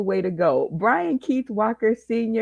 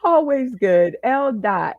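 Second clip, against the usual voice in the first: usual speaking voice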